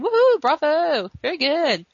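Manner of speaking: high, loud mock cheering; imitated villain's swooping bravo; gleeful Mephisto cheer. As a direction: whoop and applaud like a delighted spectator. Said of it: "Mephisto is enjoying the whole thing".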